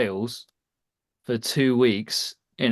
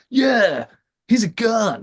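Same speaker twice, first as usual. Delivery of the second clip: hammed-up American accent, higher and louder; 10 semitones higher, 4 dB louder, slower than usual. Sales boss praises Terry at the whiteboard, mock American accent. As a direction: boss hyping his top seller in a mock American accent. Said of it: "I don't know why I'm doing the accent".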